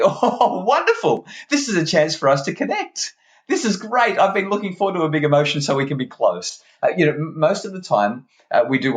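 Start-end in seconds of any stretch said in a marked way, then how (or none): 0.0-6.6 high, gushing mock cheer; mock delight. Parent to melting-down child, sarcastically overjoyed. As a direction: sound absurdly thrilled by the meltdown. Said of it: "look at their child who's having a meltdown and say".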